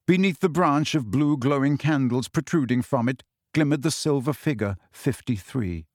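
usual speaking voice

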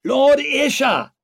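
very high, drawn-out strained yell; slow, loud strangled cry; strangled shout. A narrator's reading. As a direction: shout it back as if choked. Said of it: "came a strangled shout".

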